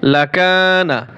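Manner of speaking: লাকানা said slowly, each long a held; a teacher reads the whole word with long vowels; slow drawn word. Shown as a demonstration. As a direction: stretch the word out. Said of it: "এক আলিফ টান দিবেন".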